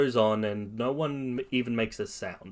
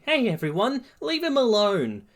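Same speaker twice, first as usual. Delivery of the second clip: put-on much higher girlish voice; protective schoolgirl; 11 semitones higher than usual. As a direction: stand up for a friend, high-pitched. Uraraka stands up for her friend.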